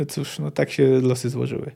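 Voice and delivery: ordinary delivery, nothing marked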